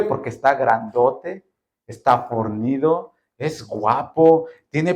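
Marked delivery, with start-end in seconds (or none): none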